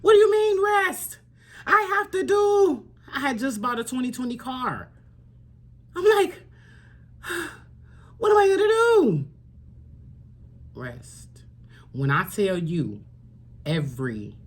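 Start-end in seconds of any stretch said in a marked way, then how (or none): 0.0-9.3 panicked past self. Retold or imitated. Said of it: "And I was like". 10.7-11.4 soft whispered single word; whispered voice of God. Retold or imitated. God's quiet answer, whispered.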